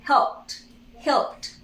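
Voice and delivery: "Helped" with the T sounding most at the end; prominent final T. Shown as a demonstration. Teacher showing what sounds most, prominent final T. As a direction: make the closing T of "helped" stand out. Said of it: "lo que más suena es t".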